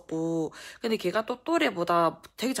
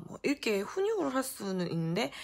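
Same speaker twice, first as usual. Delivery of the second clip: plain reading voice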